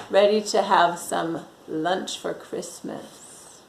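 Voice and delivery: plain reading voice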